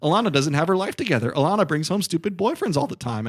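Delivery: high-pitched, loud mock tirade; imitated sister's yelling. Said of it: "Alana's yelling at Esty saying".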